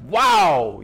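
high, loud mock-amazed exclamation; acted astonishment. Paul reacting to believers deserting Jesus, acted astonishment. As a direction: play up the amazement on one big word. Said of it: "I'm astonished that you guys".

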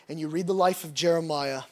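usual speaking voice

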